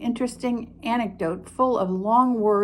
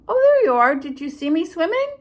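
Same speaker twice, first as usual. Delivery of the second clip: high, louder squeak; squeaky Roo; 7 semitones higher, 5 dB louder than usual. Acted. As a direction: squeak it like a small joey. Roo voiced again.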